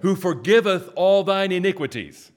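high, loud proclaiming of scripture; preaching. Acted. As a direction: call out each verse for the church to echo. Leads responsive scripture reading.

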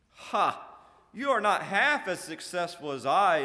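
loud, high, boastful put-down; gloating with a grin. A narrator's reading. Infidelity to Mistrust, gloating. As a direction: brag over a rival, grinning. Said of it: "Infidelity said with a grin".

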